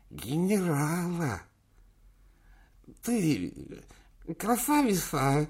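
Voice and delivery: put-on high, slow toothless old man; toothless mumble. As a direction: mumble through no teeth. Old chairman voiced.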